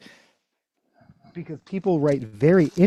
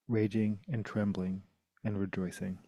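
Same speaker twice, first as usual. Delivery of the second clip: poem reading; 3 semitones lower, 7 dB quieter than usual. Acted. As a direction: let each image hang in the quiet. Reads Ted Hughes poem.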